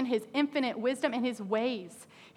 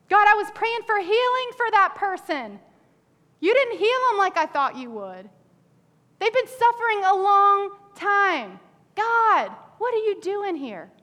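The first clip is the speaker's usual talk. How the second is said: higher, louder pleading protest; mock complaint; 7 semitones higher, 5 dB louder than usual. Preacher acts a believer questioning God, frustrated. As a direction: play the frustrated prayer.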